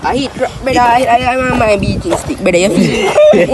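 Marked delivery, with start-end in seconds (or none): none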